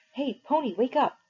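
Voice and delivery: high urgent call to wake a friend; waking call. A narrator's reading. Johnny waking Pony in the lot, high urgent call. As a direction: shake him awake with your voice.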